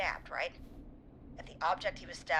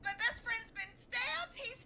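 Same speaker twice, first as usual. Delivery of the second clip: panicked caller; 14 semitones higher than usual. Acted.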